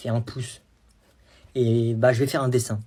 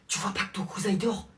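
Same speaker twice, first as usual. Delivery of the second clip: quiet angry mum; hushed scolding; 3 semitones higher, 6 dB quieter than usual. Acted. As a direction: scold under your breath. Mum scolds quietly, cousin asleep.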